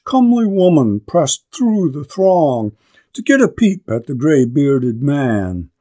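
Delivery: ordinary delivery, nothing marked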